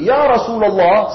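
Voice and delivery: high, loud, slow call; shouted call. Retold or imitated. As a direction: shout his name to get his attention. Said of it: "sambil teriak".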